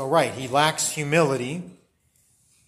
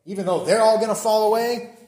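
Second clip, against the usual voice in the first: raised pitch, singling out the others; presumptuous, high-pitched aside about the others; high and mighty; 6 semitones higher, 4 dB louder than usual. Acted. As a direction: set yourself apart from them, pitched up. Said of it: "pointing to them and saying".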